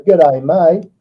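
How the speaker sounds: Aussie "G'day", said with some punch; Australian accent. Shown as a demonstration. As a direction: give it a touch of Australian accent. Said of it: "you need an Australian series".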